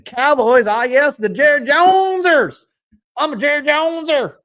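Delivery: hammed-up Jerry Jones Texan impression; Jerry Jones accent. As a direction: ham up a Texas oilman boast. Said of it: "in the Jerry Jones accent".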